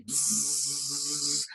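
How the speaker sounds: drawn-out bzzz of a bee; buzzing bee noise. Shown as a demonstration. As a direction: buzz like a bee flying in. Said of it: "Like this".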